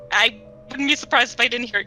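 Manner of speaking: ordinary talk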